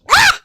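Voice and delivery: short loud squealed "Ah!"; sudden loud cry; Miss Piggy yelp. Real, not acted. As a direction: burst out with an emotional shriek. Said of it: "sound like Miss Piggy".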